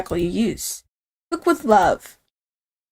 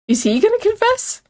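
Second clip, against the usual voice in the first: excited squeal; 11 semitones higher than usual. Real, not acted. Eager hope for confession.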